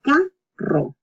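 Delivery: "Carro" split as ca, then a trilled rro; double R, by syllables. Shown as a demonstration. Teacher drilling another double R, "Carro" in two parts. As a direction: roll the rr in "Carro" after a short break. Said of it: "it's another double R".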